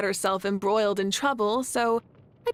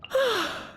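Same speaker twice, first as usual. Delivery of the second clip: tired sigh; 13 semitones higher than usual. Acted. Sigh before saying good night.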